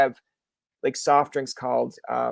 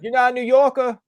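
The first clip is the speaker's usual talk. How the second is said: high, loud mock New York yell; heckling New Yorker; 10 semitones higher, 7 dB louder than usual. New Yorker to a newcomer, mock heckling yell. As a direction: jeer like a local at an outsider. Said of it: "the New Yorker will yell out".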